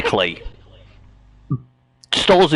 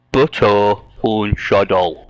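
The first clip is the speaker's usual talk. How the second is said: slow, lower, deliberate serious voice; serious deadpan; 4 semitones lower, much slower than usual. Shown as a demonstration. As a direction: intone it gravely, word by word. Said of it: "do it in a serious voice".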